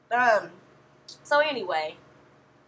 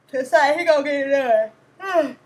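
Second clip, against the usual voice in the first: yelling; 7 dB louder than usual. Real, not acted. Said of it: "Sorry, I keep yelling".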